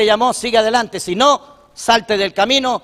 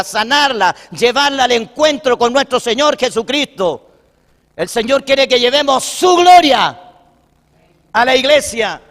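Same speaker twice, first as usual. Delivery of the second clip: raised, high-pitched sermon delivery building to a peak; forceful preaching; 5 semitones higher, 4 dB louder than usual. Real, not acted. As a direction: drive each phrase home to the congregation. Predica con fuerza al concluir. (predicación enérgica y en alto)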